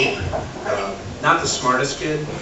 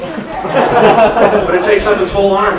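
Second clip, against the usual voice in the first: laughing; 8 semitones higher, 7 dB louder, much slower than usual. Real, not acted. Comic punchline, laughing.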